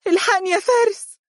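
very high-pitched cry for help. A narrator's reading. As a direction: call him urgently in a high, panicked voice. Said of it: "وهي تهتف". (هتاف استغاثة)

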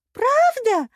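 a high, loud, beaming «Правда?». A narrator's reading. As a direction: burst out with joy. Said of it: "просияла дурнушка".

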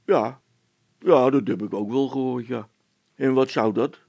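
a policeman brushing it off; gruff officer. A narrator's reading. Officer dismisses them. (stoere veldwachter, wuift het weg)

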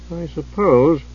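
hesitant, reluctant agreement; cautious. Acted. Chislev reluctantly agreeing with Hidjukel, cautious. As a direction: concede it without trusting him. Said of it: "Chislev said cautiously".

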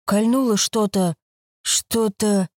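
sleepy, drowsy voice; drifting off while talking; nodding off. A narrator's reading. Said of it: "повторил косой сонным голосом".

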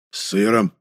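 understated, with a hint of significance; meaningful. A narrator's reading. Печкин presents his pastry, meaningful. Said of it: "сказал он многозначительно".

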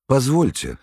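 high-pitched startled outburst; sudden exclamation. A narrator's reading. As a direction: exclaim it abruptly, pitch jumping up. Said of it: "вдруг воскликнула она". (внезапное восклицание)

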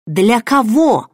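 slow, loud cry that cracks; voice breaking shout. Acted. Heroine cries out at the man calming her, voice breaking. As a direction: push the words till the voice breaks. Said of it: "закричала я, и мой голос сорвался".

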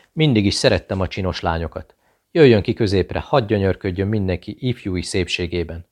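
plain reading voice

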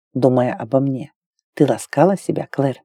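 low, softened man's question; lightly voiced male lover. A narrator's reading. Imagined lover questions Claire intimately, low soft male voice.

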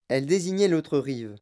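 plain reading voice

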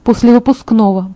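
ordinary delivery

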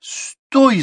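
high, loud shouted command. A narrator's reading. Official to departing rider, high shout to stop. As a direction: yell sharply to halt someone. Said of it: "закричал Липатыч".

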